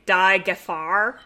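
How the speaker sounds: Die Gefahr said slowly, spelling out the German; slow, unsure German for the danger; attempt at German. Real, not acted. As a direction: try the German Die Gefahr carefully, as a guess. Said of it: "was it the danger, but in German?".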